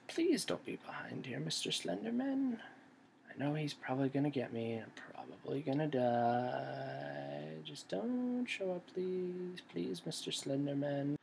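small, wheedling sing-song plea; soft pleading. Pretend. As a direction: plead quietly with the monster, stretching the words. Begging the monster not to appear.

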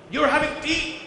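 You're said loud and high, having tea falling away; You're stressed, loud and high. Shown as a demonstration. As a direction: accuse the person, hit You're. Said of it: "It completely changes the meaning".

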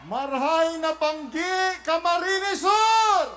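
drawn-out, rising shout to the crowd; rally greeting. Real, not acted. Candidate greets the Camarines Sur crowd, calling out.

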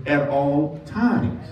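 slow, stretched "At all times"; preacher repeating "At all times" slowly; slow emphatic reading. Shown as a demonstration. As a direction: drag it out for weight. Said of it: "And then it says".

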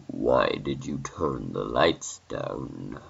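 low, slow, swooping creepy voice; menacing caller. Acted. Stranger letting the babysitter know he is watching, slow and menacing. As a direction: draw the question out to unsettle.

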